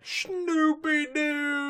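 high, slow drawn-out call; lovestruck calling out. Retold or imitated. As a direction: croon the pet name dreamily. Calling for each other.

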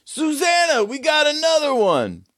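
high shout over the shoulder; the host calling back to his partner about a new arrival. Acted. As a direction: call out to Susanna in the back. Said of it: "he goes".

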